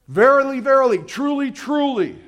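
high, loud, ringing verily; emphatic proclamation. Acted. Preacher voicing Jesus, loudly proclaiming to the crowd. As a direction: proclaim it so no one misses it.

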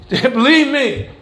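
ordinary talk

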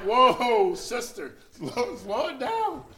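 laughing plea to ease up; whoa, slow down. Retold or imitated. Preacher calling to a woman running at him, loud and amused.

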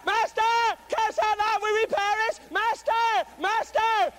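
desperate high-pitched shouts for rescue; frantic, repeated loud cries; crying and hollering. Acted. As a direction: holler out to the Master as if drowning. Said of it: "Cut out that crying and hollering".

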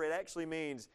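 usual speaking voice

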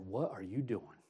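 low, hushed reproof; quiet retold rebuke. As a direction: softly voice a sobering question. The Lord quietly rebuking him, retold.

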